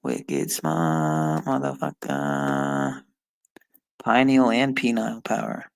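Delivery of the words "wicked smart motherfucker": lower, slow drawn-out put-on voice; a lowered, lazy mock swagger; mock tough-guy boast. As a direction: ham up the brag in a low, slow voice.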